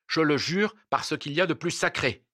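high-pitched, impassioned husband; fervent oath. A narrator's reading. Desperate husband swearing an oath.

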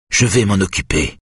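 usual speaking voice

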